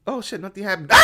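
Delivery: high, loud panicked oh my God; panicking at a horror film, then laughing; terrified moviegoer. Pretend. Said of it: "You're gonna be like".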